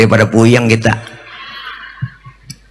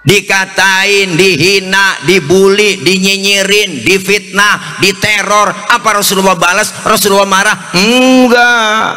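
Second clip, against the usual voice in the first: preaching; 6 semitones higher than usual. Acted. Preaching lift.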